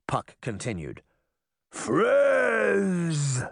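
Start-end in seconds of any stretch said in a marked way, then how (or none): 1.7-3.4 high, drawn-out giant cry; stretched storybook giant roar; giant's roar. A narrator's reading. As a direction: roar the word in one long falling swoop. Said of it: "the giant roared".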